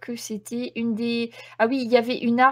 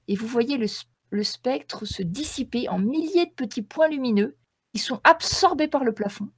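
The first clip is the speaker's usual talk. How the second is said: ordinary talk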